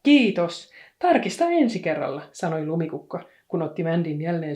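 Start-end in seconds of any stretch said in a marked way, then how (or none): 0.0-2.2 cheeky farewell. A narrator's reading. Girl leaves cheekily.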